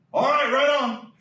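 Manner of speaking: the dad backing off, now pleased; mollified dad. Retold or imitated. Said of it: "He's like".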